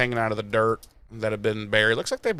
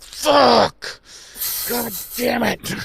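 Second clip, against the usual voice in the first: much higher, louder frustrated yell; frustrated outburst; 11 semitones higher, 4 dB louder, much slower than usual. Acted. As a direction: explode in frustration at being stuck. Buffy frustrated stuck in hole.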